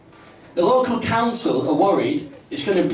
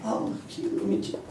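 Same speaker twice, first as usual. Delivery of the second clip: mock cooing; 5 semitones higher, 10 dB quieter than usual. Crowd cooing at the man.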